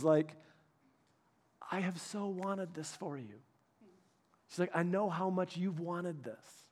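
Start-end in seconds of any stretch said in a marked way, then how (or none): 1.7-6.6 tearful wife. Retold or imitated. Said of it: "and she's crying".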